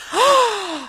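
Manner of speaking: a sudden high gasp; sharp gasp. Retold or imitated. Mother startled by a spider moving in her face, sharp high gasp. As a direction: gasp as if the spider jumped at you.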